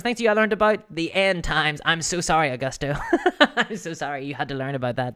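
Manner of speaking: ordinary talk, nothing marked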